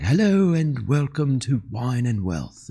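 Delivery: imitating a smoky British announcer, slowed; a light go at a raspy British intro voice; slow smoky British welcome. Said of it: "the really raspy, like, smoky voice".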